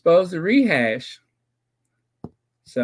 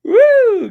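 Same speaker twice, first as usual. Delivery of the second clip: excited whoop; 10 dB louder than usual. Real, not acted. Gotcha shout after pause.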